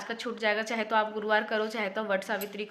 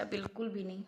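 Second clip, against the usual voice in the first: dejected echo; 3 semitones lower, 11 dB quieter than usual. Real, not acted. Disappointed wife sighing to viewers over mehndi, soft dejected echo. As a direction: repeat it much softer, trailing off in letdown.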